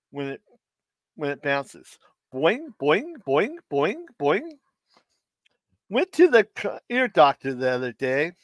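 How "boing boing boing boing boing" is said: higher, springy cartoon boings; bouncy boing sounds. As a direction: bounce each boing like a rubber ball. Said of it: "What sound does a seven forty-seven makes".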